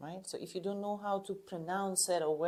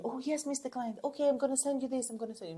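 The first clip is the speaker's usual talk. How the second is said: higher, fast, fawning yes-man voice; mock eager order-taker; 8 semitones higher, faster than usual. Coach mocking a submissive salesperson, higher and fast. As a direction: play the eager servant to the client. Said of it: "you are like an order taking".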